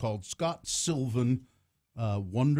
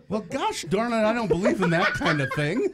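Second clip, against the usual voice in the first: high, loud mock-senator voice; Orrin Hatch; 7 semitones higher, 6 dB louder than usual. Guest disowning the trick as a senator, high indignant impression. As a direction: protest like a scandalised pious politician. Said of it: "If Orrin Hatch tells me to".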